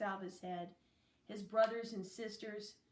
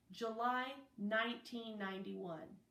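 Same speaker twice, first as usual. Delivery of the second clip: ordinary delivery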